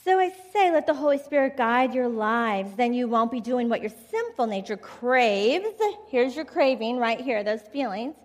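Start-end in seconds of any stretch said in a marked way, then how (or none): 0.0-5.7 scripture reading. Acted. Reads scripture aloud to congregation.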